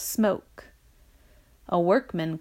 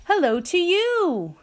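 high, drawn-out answering call; slow sing-out shout, pitched up; shouting back; 9 semitones higher, 4 dB louder than usual. A narrator's reading. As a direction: holler a greeting back up. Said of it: "they shouted back".

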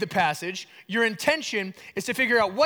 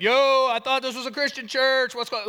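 mock shocked objection; 5 semitones higher, 5 dB louder than usual. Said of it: "some of you are like".

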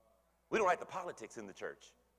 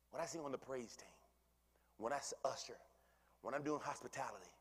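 hushed confiding; 3 semitones lower, 11 dB quieter than usual. Real, not acted. Preacher confiding his service to the congregation, whispered. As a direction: drop to a near whisper.

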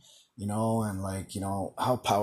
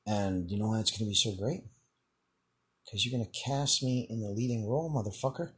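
understated, softer swagger with long pauses; cocky fantasy reply; 4 dB quieter than usual. Pretend. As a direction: play at being the sought-after writer, unhurried. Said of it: "sound like an arrogant".

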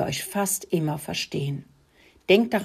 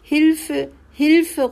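high, loud cry for help; choking call for help; 9 semitones higher, 7 dB louder than usual. A narrator's reading. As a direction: call out as air runs short. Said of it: "röchelte Rudi". (hoher, verzweifelter Hilferuf)

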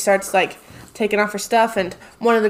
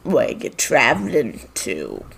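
low goblin question; 9 semitones lower than usual. Acted. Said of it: "he addresses you guys and goes".

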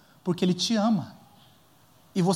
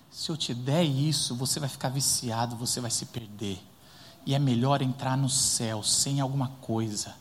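gentle God; 8 semitones lower, 4 dB quieter than usual. Acted. Pastor voicing God gently refusing a gift, soft and tender. God's gentle answer voiced.

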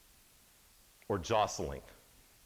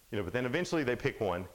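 normal talking voice

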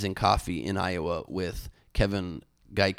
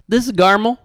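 much higher, louder Southern woman's voice; bad Southern accent; 13 semitones higher, 12 dB louder than usual. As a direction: put on a hammed-up Southern belle. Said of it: "I did a Southern accent".

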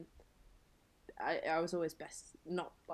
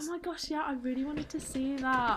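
high, louder, heartfelt exclamation; real eagerness; 7 semitones higher, 6 dB louder than usual. Real, not acted. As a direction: burst in eagerly, pitched well up. Eager reply about missing the friend's show.

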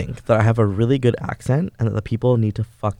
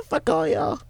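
silly high voice; 6 dB quieter than usual. Pretend.

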